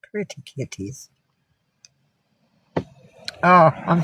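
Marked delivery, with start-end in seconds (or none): 0.1-1.1 much softer coo, "Pretty" stressed, falling at the end; low murmured pet talk; cooing to cats. Real, not acted. Greeting her cats again.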